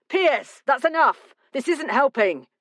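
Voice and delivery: sharp order to stop fighting; urgent intervention. Acted. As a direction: call him off firmly. Tom restrains Pierce in a fight.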